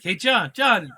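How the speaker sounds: loud high call of the name. Retold or imitated. Brad calling John Stamos over, loud call. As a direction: hail him across the room. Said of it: "he's like".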